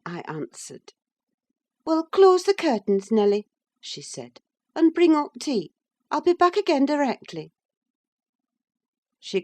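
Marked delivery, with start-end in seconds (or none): 1.9-3.5 high, louder mistress voice giving an order; brisk young lady commanding her maid; lady ordering her servant, high and bright-voiced; Catherine giving orders. 4.7-7.5 high, louder mistress voice giving an order; brisk young lady commanding her maid; lady ordering her servant, high and bright-voiced; Catherine giving orders.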